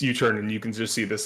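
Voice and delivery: normal talking voice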